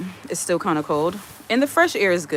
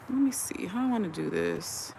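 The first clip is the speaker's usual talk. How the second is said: soft, half-whispered thinking aloud; murmuring to oneself; 3 semitones higher, 8 dB quieter than usual. Real, not acted. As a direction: think aloud under your breath. Talking to herself while working something out.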